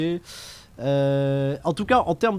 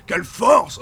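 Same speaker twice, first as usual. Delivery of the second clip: excited shout; 6 semitones higher, 6 dB louder, slower than usual. Real, not acted. Excited wrestling commentary.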